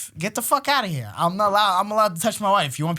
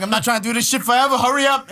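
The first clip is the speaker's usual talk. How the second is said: impatient urging; 6 semitones higher, 5 dB louder than usual. Retold or imitated. Acting himself rushing wife.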